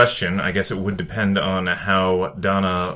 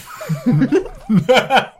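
high laugh; 12 semitones higher than usual. Acted. Robot character amused by request.

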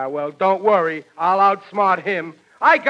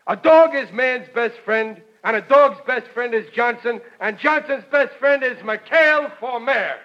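campaign slogan; 5 semitones higher than usual. Acted. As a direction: proclaim it like a rally chant. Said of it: "I got a great campaign slogan".

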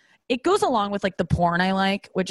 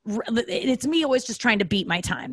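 ordinary delivery

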